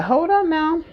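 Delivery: high, sassy stop-right-there; mock scolding to your own mind; firm hold on. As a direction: check yourself sharply. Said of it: "you have to be like".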